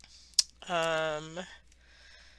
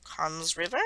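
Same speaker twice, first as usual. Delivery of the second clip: Cairns said the Australian way, with no R; "Cairns River" in an Australian accent, rising at the end; 3 dB quieter than usual. Shown as a demonstration. Traveller showing how locals say Cairns, Australian accent. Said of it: "they say it like that".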